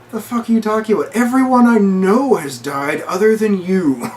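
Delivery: high, loud retold outburst; angry outburst. Ellie firing back at Joel about loss, loudly upset. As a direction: hurl it back high and loud, stretching the end.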